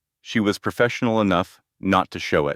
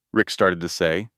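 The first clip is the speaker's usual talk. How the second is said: ordinary delivery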